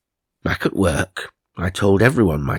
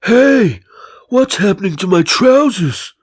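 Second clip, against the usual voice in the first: loud, much higher alarmed cry; shouting in shock, far higher; startled shout; 12 semitones higher, 6 dB louder than usual. Retold or imitated. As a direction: yell as if your trousers just split. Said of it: "suddenly shouted".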